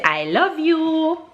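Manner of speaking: high, drawn-out sing-song to a little one. Real, not acted. Coaxing toddler to repeat words.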